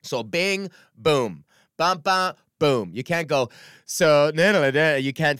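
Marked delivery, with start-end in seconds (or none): none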